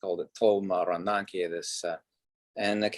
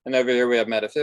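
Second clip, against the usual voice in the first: scoffing, singsong voice, much higher and louder; mock the people who split science from metaphysics, pitched up and loud; mocking quote; 3 semitones higher, 7 dB louder, faster than usual.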